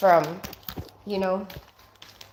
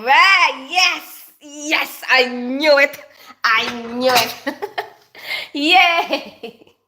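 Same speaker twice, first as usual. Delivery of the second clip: excited cheering; 3 semitones higher, 5 dB louder, much slower than usual. Real, not acted. Cheers after guessing right.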